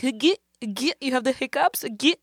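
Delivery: put-on sing-song taunt of a crying kid; mocking kids. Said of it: "mimicking the sound of my hiccup".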